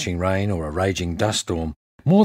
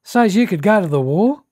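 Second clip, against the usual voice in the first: high, louder mimicked boy's voice; little boy; 11 semitones higher, 5 dB louder than usual. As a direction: pipe up like an eager little boy. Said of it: "a little boy came running up to me".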